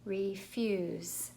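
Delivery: "Refuse" slowly, in two stretched syllables; sounding out. Shown as a demonstration. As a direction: draw out each part of "Refuse". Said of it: "So let's try it".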